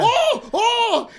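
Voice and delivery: loud, high "oh!" of shock; shocked reaction. Retold or imitated. Acting out viewers' reaction to the show, shocked. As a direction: cry out as if the twist just hit.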